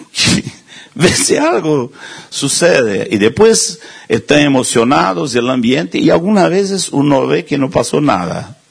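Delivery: ordinary talk, nothing marked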